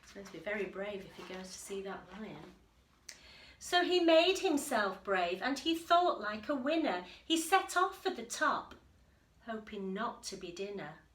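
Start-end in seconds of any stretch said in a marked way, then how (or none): 3.6-11.0 lively read-aloud voice, a little louder; story narration. A narrator's reading. Teacher reading the picture book to the class, lively storytelling. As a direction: read it to the children with bounce.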